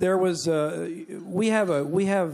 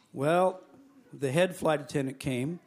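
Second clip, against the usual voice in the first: ordinary delivery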